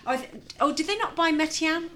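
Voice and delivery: ordinary talk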